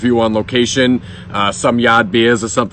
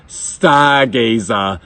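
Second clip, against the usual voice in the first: very slow, stretched-out Boston accent; exaggerated Boston accent; much slower than usual. Shown as a demonstration. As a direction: savour each syllable in broad Boston. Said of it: "the best Boston accent band name".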